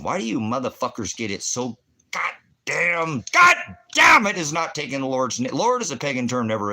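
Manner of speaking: rising from a heated jab into a full shout; angry shout. Real, not acted. Preacher arguing with the crowd, shouting angrily.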